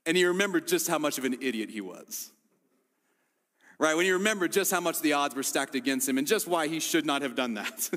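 ordinary talk, nothing marked

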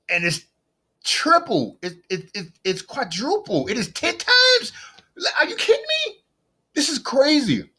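high, incredulous outburst; excited. Real, not acted. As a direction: escalate in amazed disbelief, voice soaring. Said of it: "see, I'm excited".

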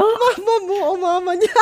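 high-pitched laugh through the words; giggling out the question. Real, not acted. Teasing, bursts out laughing.

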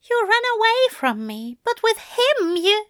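high, wounded accusation; hurt, high angel voice. A narrator's reading. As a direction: accuse him tearfully, pitched well up.

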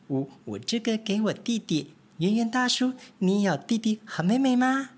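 high, lively little mouse voices; little mice. Acted. Three little mice chattering to each other, high and eager.

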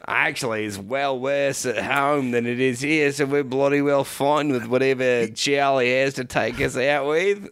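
put-on Australian bloke; Australian accent. Bloke weighs war against home, mock Australian accent. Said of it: "it's just all Australian".

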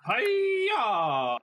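karate yell. Acted. Karate chop battle cry.

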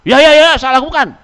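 loud, high, impatient "Ya, ya, ya"; grudging snappy yes. Pretend. Child snapping back at parents, high loud impatient yes. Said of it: "dengan intonasi suara".

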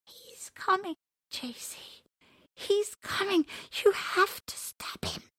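high urgent whisper; frightened whisper. Acted. Elephant begs J C to stop the fox, high whisper. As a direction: plead in a hushed panic. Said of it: "and whispered".

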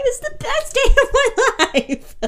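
high, laughing victory squeal; imitated elation. Little sister finally beating her brother, imitated elated squeal. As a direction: squeal it in triumph. Retold joy at finally winning.

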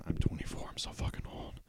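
breathy whisper, self-mocking; whispered aside. Real, not acted. Vlogger muttering about his age, whispered. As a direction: drop to a whisper for the joke.

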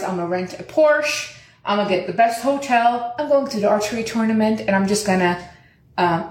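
usual speaking voice

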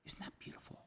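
whispered, reverent aside; awed whisper. Real, not acted. Preacher moved by Hagar's name for God, whispered. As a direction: drop into a whisper to share the wonder.

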